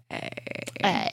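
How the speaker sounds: put-on creaky breathless valley girl Hey; Hey in a fried valley girl voice; vocal fry. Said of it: "So much vocal fry".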